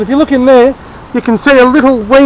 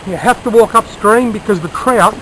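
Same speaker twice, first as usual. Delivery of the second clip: low, soft voice so as not to spook fish; hushed talking; 4 semitones lower, 7 dB quieter than usual. Real, not acted. Angler stalking trout up a mountain stream, hushed talking. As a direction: keep it down, stealthy and low. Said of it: "I can't talk as loud here".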